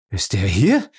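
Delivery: startled. A narrator's reading. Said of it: "fragte Lacey erschrocken".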